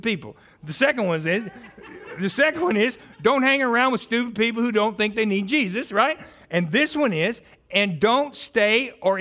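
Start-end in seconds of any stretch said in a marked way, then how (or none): none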